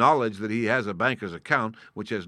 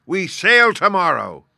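high, loud, drawn-out proclamation; triumphant shout; 8 semitones higher, 10 dB louder, slower than usual. A narrator's reading. Squire announces the departure to the boy, shouting triumphantly. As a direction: ring it out slowly, falling at the end.

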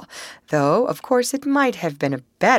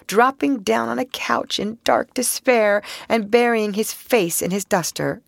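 usual speaking voice

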